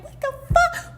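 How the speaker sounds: squeaky high mock disbelief with a laugh; imitating Gerald's high laughing outrage; Gerald exasperated. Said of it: "Gerald's like".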